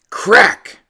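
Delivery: high, loud burst; sharp exclamation. Acted. Narrator bursting out at the hit, loud. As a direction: pop it like the crack of the bat. Bat hits the ball.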